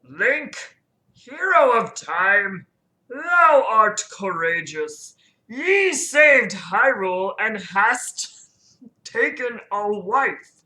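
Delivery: put-on slow, grand Old English proclamation; ancient talking tree. Deku Tree honours the hero, slow and grand. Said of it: "Deku Tree speaks in Old English".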